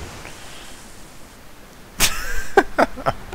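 high, unvoiced wheezing laughter; helpless laugh. Real, not acted. Player laughs at failing again, helpless. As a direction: laugh helplessly at your failure.

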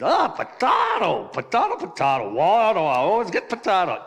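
loud, high mock-Irish lament; exasperated Irishman in a joke; bad Irish accent. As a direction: complain in a badly faked Irish brogue. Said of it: "Irish accent".

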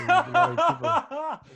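talking through laughter; laughing. Real, not acted. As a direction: laugh as you say it.

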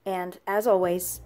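usual speaking voice

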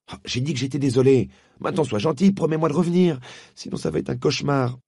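put-on boy begging her to come back. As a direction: coax her to promise. Diggory begs.

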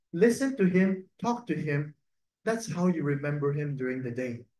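usual speaking voice

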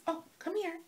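high, soft call to a cat; coaxing pet voice. Real, not acted. Calling the cat to her.

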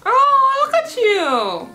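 high, loud delighted cry; surprised and proud; excited praise. Real, not acted. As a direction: cheer her on with delight. Praising friend's correct answer.

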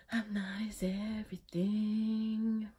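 slow, voiceless whisper; slow whispered wrap-up. Real, not acted. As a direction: whisper the sign-off slowly. Whispered sign-off.

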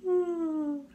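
high, drawn-out yawn sound; imitated caveman yawn. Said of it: "and he went".

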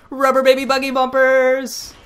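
high loud cartoonish silly voice; goofy high voice. Co-host clowning over the reading, high loud goofy voice. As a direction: blurt it like a cartoon goof. Goofy tongue twister interruption.

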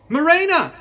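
piercing high scream of a name; shriek. A narrator's reading. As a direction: shriek it in terror. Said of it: "Gladys shrieked".

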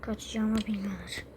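hushed, unvoiced aside; sly whispered aside. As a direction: whisper the plan to yourself. Character whispers escape plan aside.

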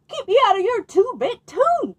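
the dog barks at the farmer; barking dog. Acted. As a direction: snap it out like a cross dog. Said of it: "barked the dog".

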